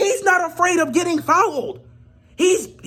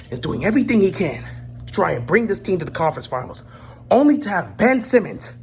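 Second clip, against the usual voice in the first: ordinary talk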